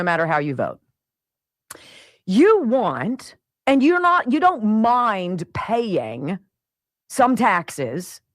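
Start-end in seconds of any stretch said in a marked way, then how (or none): none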